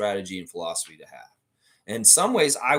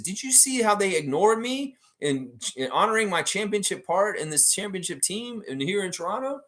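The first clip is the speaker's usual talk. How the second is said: mock aggrieved coach; 8 semitones higher than usual. Imagined coach complaining at halftime.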